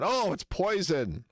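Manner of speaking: high, drawn-out mocking quote; mock dismay. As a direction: imitate an overdramatic objection, slowly. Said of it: "he says it later on".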